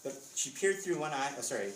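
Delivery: usual speaking voice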